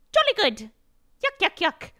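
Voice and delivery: high, loud caricature English toff; hammed-up British. Shown as a demonstration. Streamer showing how hard she leans, hammed-up British caricature. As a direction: push a silly British accent up high. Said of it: "if I lean hard".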